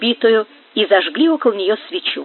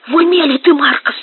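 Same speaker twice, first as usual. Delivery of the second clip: higher, louder startled question; alarmed protest; 6 semitones higher, 4 dB louder than usual. A narrator's reading. Gomes to Marcos as he aims at the ghost, alarmed. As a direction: challenge a friend's sanity in alarm.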